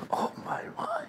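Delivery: breathless startled whisper; awed whisper. Retold or imitated. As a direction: whisper it, spooked by the voice. Startled by the voice calling.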